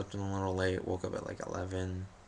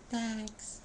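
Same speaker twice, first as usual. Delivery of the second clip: much higher, small grateful thanks; meek thanks; 14 semitones higher, 3 dB quieter than usual. Retold or imitated. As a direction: thank her in a tiny voice. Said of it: "so just like".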